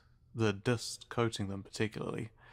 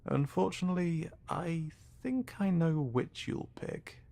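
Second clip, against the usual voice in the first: knowing taunt; 8 semitones higher than usual. Acted. Interrogator taunts the captive.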